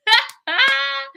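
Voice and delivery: high burst of laughter. Real, not acted. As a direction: giggle up high mid-bit. Laughs between takes.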